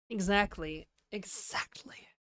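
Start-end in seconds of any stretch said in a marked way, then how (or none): 1.1-2.1 breathy whispered repeat; whispering. Pretend. Repeats word, then whispers it.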